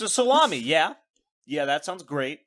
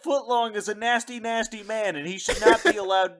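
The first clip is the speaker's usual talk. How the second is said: ordinary talk, nothing marked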